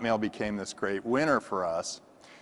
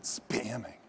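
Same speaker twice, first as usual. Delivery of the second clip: softer, hushed, like a dirty word; ominous; 9 dB quieter than usual. Retold or imitated. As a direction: say it like something shameful. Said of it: "I knew it was a bad thing".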